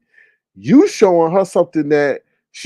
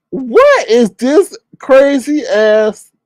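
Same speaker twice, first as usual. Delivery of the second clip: mock outrage; 7 semitones higher, 6 dB louder, much slower than usual. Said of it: "I was like".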